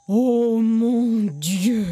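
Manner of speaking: very slow, drawn-out dismay; aunt's exclamation. A narrator's reading. Aunt hearing of the sacred water, drawn-out dismay. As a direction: stretch each word in shock. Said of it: "s'écrie Tanti".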